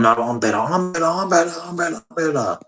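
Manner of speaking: high whiny mimicry of one-upmanship; mocking braggarts. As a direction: chant it high, mocking the boasters.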